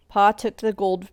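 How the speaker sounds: plain reading voice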